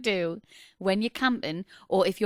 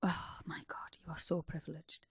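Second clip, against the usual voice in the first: whispered mock outrage; 3 semitones lower, 13 dB quieter than usual. Real, not acted.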